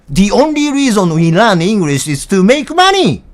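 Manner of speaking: loud, high, slow declaration in English; emphatic outburst. Real, not acted. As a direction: hammer it out word by word, loudly. Emphatic switch to English tirade.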